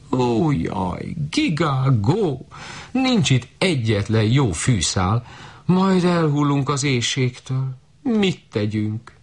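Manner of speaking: high, slow goose wail; lamenting goose. Acted. Starving geese wailing to the shepherd girl, high and slow.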